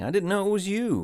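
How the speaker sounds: high, startled protest; flustered surprise. Acted. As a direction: blurt an excuse, caught off guard. Husband startled by wife's early arrival.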